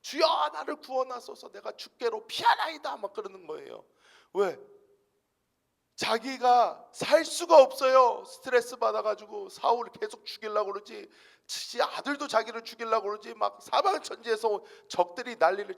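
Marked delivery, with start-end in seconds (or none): 0.0-3.0 high, loud cry for rescue; loud, high plea for saving; wailing prayer. Retold or imitated. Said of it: "울부짖는 거예요". 6.0-15.7 impassioned preacher's build; fiery preaching. Acted. Preaching David's plight loudly.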